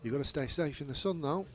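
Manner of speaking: ordinary talk, nothing marked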